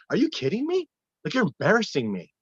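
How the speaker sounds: mock exasperation. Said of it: "I go".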